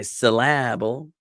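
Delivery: "syllable" as suh-LAB-ul; stress on LA. Shown as a demonstration. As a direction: move the emphasis of "syllable" onto middle "la". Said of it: "with the emphasis on la".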